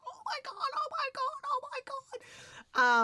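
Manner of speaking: tiny, very high-pitched fangirl squeal; high squeaky mock freak-out, held in; silent scream. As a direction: squeal it in a thin, stifled falsetto. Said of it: "it is screaming".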